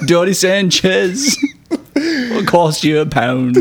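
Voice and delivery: mock Cockney. Continuing Cockney bit.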